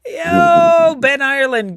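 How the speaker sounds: loud, high-pitched "yo"; a loud, joyous cry of "yo" on spotting a friend; excited shout. Real, not acted. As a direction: hail a buddy with a laughing shout. Calling out greeting to a friend.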